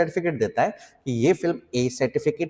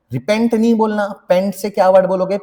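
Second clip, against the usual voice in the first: emphatic quiz; 5 semitones higher, 7 dB louder than usual. Real, not acted. Teacher quizzing class loudly.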